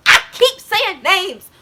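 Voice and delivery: loud, high, drawn-out self-reproach; frustrated outburst. Real, not acted. Vlogger catches own slip, exasperated outburst. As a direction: blurt it out, exasperated at yourself.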